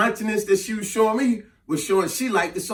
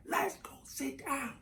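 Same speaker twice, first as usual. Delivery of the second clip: slow, very soft, higher voice; barely audible; 5 semitones higher, 15 dB quieter, slower than usual. Retold or imitated. Woman asking over club music, hushed slow imitation. Her words lost under loud music.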